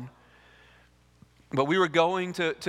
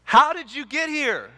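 much higher, louder baffled outburst; acted exasperation; 9 semitones higher, 6 dB louder than usual. Baffled guy in heaven demands an explanation from criminal, exasperated. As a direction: demand it in loud, high-pitched disbelief. Questioner repeats, now exasperated.

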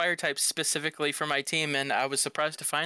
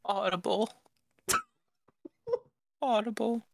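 higher, goofy put-on voice; silly voice; 7 semitones higher than usual. Clowning while host plugs Audible, silly voice. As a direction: say the brand name in a daft high voice.

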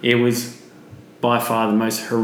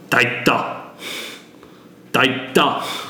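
weird possessed voice; much slower than usual. Retold or imitated. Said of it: "in this weird voice that I've just picked up".

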